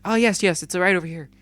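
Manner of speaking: sweet service voice, clearly put on; fake-polite customer voice. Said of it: "And then I'll be like".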